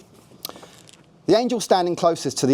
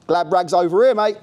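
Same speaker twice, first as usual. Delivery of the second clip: shout; 6 semitones higher, 3 dB louder than usual. A narrator's reading. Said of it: "shouted over to me".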